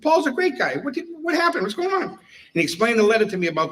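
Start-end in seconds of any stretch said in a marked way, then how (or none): 0.0-2.0 high and sing-song, fake concern; mock-sympathetic high voice. Teller baiting the annoyed chief, mock-sympathetic high voice. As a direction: feign concern to bait the chief, pitched way up.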